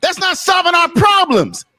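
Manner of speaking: angry yelling. Real, not acted. Panelist yelling at the host over a chat message, furious shout. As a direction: hammer the point home, fed up. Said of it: "was he really yelling at you".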